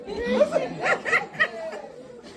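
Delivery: a long, high burst of laughter. Real, not acted. Midwife laughing at the husband's shock, high and long. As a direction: crack up at the memory.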